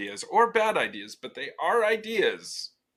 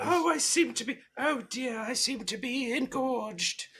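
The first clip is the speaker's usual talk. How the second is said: put-on high, breathy-free prim old English lady, slowed; fluttery old lady gasping in shock; mock prim spinster voice; Miss Marple; 9 semitones higher, 5 dB quieter than usual. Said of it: "He just turned into Miss Marple".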